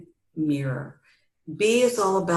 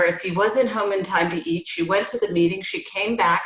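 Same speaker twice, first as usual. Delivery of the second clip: normal talking voice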